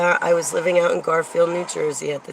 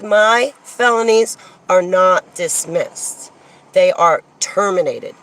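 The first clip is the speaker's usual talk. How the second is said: loud, deliberate stress on "dismissed" and "terminated"; emphatic correction; 2 semitones higher, 5 dB louder than usual. Real, not acted. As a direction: spell out the distinction, leaning hard on "terminated". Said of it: "I would like to highlight that terminology".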